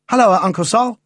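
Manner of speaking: put-on excited boy calling out high; cheerful lad shouting a greeting; child voice. A narrator's reading. Boy bursts in greeting uncle.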